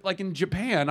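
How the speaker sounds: ordinary delivery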